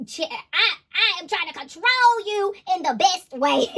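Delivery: high, loud, slow mock masculine voice; mock possessive man. Tarot reader voicing a possessive King of Cups, loud and mocking. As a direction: ham up a controlling lover. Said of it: "masculine energy that's like".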